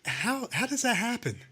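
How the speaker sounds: high, disbelieving question; incredulous. Real, not acted. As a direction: ask it like you can't believe it. Incredulous rant about plowing.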